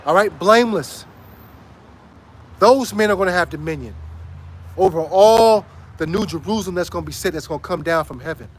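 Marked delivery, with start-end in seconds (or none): none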